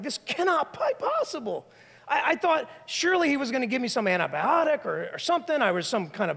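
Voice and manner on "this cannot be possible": higher, voiced disbelief; stunned disbelief. Woman just diagnosed, speaking in voiced shock. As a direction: say it like you can't believe it.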